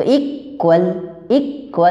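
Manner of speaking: Equal broken after इक with a pause; syllable demo of equal; split into syllables. Shown as a demonstration. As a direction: say equal as इक, gap, वल. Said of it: "और syllable मतलब क्या".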